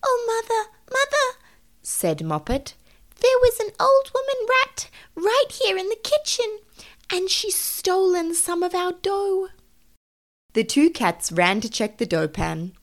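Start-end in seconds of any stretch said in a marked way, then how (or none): 0.0-1.3 very high, frightened kitten voice. Acted. Moppet to her mother after seeing a rat, frightened. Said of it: "She seemed to be in a terrible fright". 3.2-9.5 very high, frightened little kitten. Acted. Moppet telling mother of the rat thief, frightened. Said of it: "She seemed to be in a terrible fright".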